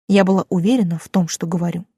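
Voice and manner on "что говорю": ordinary delivery, nothing marked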